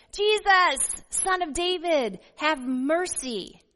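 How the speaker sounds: high, loud cry, have mercy stretched slow; shouting out. A narrator's reading. Blind beggar shouting to Jesus over the crowd, loud high cry. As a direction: call out to be heard over a crowd. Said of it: "he began to shout out and say".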